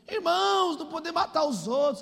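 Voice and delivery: high imitated pulpit call; preaching pastor. Pastor warns the church, high preaching voice. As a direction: call out, then deliver the warning briskly. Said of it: "o pastor tava pregando".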